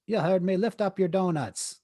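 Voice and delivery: high, loud imitation in a Caribbean accent; Caribbean lady insisting. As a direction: imitate a Jamaican carer repeating her order firmly. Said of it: "lady with Jamaican slash Caribbean accent".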